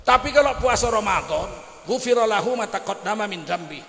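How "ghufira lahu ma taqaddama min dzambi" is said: normal talking voice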